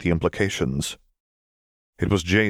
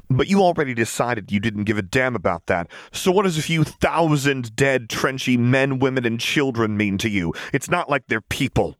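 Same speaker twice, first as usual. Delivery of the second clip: bitter sarcasm; 7 semitones higher than usual. A narrator's reading. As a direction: sneer it with contempt. Vi's bitter sarcastic accusation.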